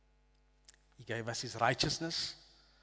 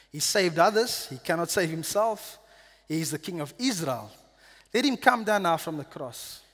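mocking taunt; 3 semitones higher, 7 dB louder than usual. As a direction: sneer it up at him. Chief priests mock Jesus.

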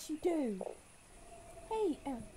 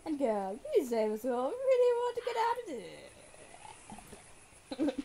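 put-on voice; 3 semitones higher, 8 dB louder than usual. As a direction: overdo the character voice for the girl. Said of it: "Time to put on the voice".

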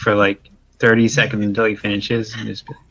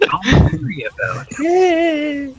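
slow, high and stretched out; drawn-out mock; 13 semitones higher, much slower than usual. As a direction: draw the words out slowly and high. Running joke, drawn-out take.

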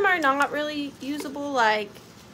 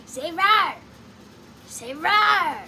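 put-on raised, louder movie-kid voice; imagined kid's voice; 4 semitones higher, 4 dB louder, much slower than usual. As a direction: mimic a demanding little kid, pitched up. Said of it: "what I feel like he would say".

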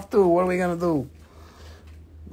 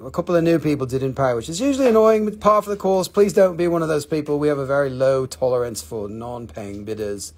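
ordinary talk

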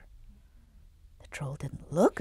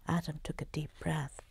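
ordinary delivery

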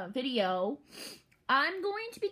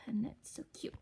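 soft unvoiced whisper; 2 semitones lower, 9 dB quieter than usual. Real, not acted. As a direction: whisper it secretly. Whispering about new toy.

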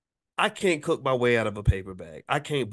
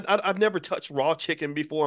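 normal talking voice

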